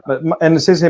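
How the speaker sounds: usual speaking voice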